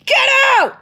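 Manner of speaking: very high, loud comic scream; full-out yell. Retold or imitated. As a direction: shriek it to drive him out. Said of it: "in the middle of me yelling".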